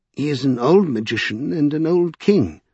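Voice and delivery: ordinary delivery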